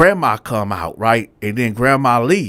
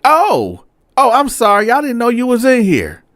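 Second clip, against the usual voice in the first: lightly voiced flustered old man, pitched higher; flustered cellmate; 12 semitones higher, 4 dB louder than usual. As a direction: play him caught out and apologizing, higher up. Cellmate caught naked, voiced apologizing.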